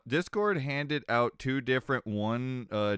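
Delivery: normal talking voice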